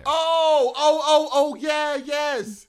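loud, high burst of delight; excited recognition. Real, not acted. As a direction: shout it as it suddenly clicks.

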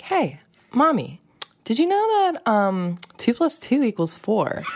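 like a jolly waiter pouring coffee; cheerful coffee-server kid. Retold or imitated. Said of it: "like a middle-aged man serving you coffee".

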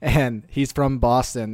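ordinary talk, nothing marked

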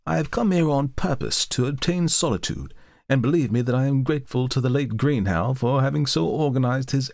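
a lightly voiced gentleman, quick and firm; hasty young scholar. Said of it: "said Malcolmson hastily".

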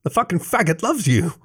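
high-pitched bitter cry; anguished outburst. A narrator's reading. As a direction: hurl it out in self-loathing. Self-hating shouted confession.

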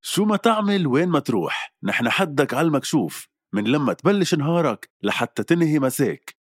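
high, punchy commercial voiceover; ad read. Acted. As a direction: pitch it like a TV ad. Reads radio ad script.

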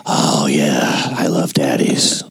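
low breathy whispered come-on; sultry whisper. Pretend. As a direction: whisper it like a mock seductress.

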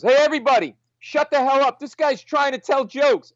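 put-on high, loud drunken holler; imitated drunk quieting a crowd; calling to the bar. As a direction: bellow for the whole room to shut up. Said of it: "he turns back to the bar".

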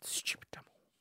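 hushed, unvoiced aside; whispered insult. Real, not acted. Comedian mutters an insult as an aside, whispered. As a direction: breathe the insult under your breath.

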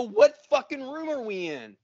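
high-pitched, irritated question; retold exasperation. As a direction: act out losing patience with the clerk. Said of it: "I'm getting fucking mad and elevated".

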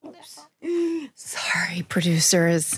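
unvoiced sheepish whisper. Real, not acted. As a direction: whisper an apology. Apologizing after cursing on air.

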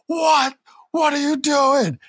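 retold anguished yell at his brother; shocked outburst. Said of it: "And Jey's like".

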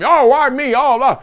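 high, loud self-pitying whine; mock victim whine. Watson whining about his bad luck, pitiful and high. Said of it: "Oh, you playing victim".

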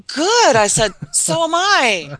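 high, slow, pointed retort; tough comeback. Retold or imitated. Telling the builder she is one too, slow and pointed. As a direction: put the builder in his place. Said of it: "And I go".

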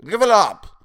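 high, loud creature yell; crying out. A narrator's reading. Creature repelling the man who leapt on him, crying out. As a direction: yell to shake off the attacker. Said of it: "Toadface cried".

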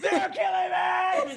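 high-pitched hammed-up scream; imitated screaming. Psychic face screaming at the dad, high mock scream. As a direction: cry out as a giant psychic face. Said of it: "this giant face screaming at you".

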